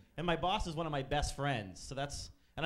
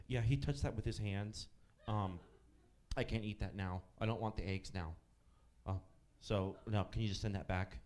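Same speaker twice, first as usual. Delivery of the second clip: lower, whiny mock-woman voice; fussy customer; 6 semitones lower than usual. Comic imitating the woman returning eggs, lower.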